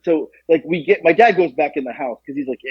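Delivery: ordinary talk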